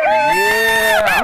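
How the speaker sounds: huge high cheering yell; excited show-opening scream; hype shout. Real, not acted. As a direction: shout it out like the crowd. Hosts cheering show return.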